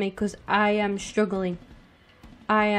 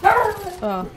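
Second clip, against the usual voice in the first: surprised; 10 semitones higher, 8 dB louder than usual. Real, not acted. Startled by the doorbell.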